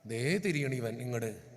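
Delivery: slow, low teasing remark; amused low aside. Real, not acted. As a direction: poke fun at the man gently. Amused at man turning wrong way.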